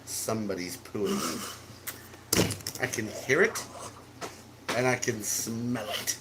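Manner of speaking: creepy teasing. Pretend. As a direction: tease slyly in a low, soft voice. Creepy taunt to make friend laugh.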